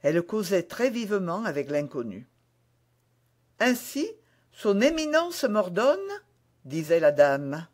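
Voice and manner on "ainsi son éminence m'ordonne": higher-pitched lady's voice; lifted, querying feminine character voice. A narrator's reading. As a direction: voice a lady questioning her orders, pitched up. Lady's dialogue voiced in narration.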